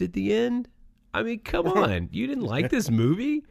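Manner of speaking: high-pitched pleading disbelief; incredulous. Real, not acted. Host presses co-host who disliked the movie, incredulous.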